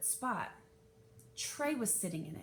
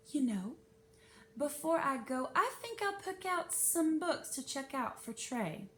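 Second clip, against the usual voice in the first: sweet; 6 semitones higher than usual. Acted. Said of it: "then smiled sweetly".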